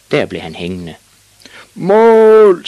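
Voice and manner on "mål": very loud, high, drawn-out goal cry; screamed. Acted. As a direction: scream the goal across the pitch. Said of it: "skreg dommeren".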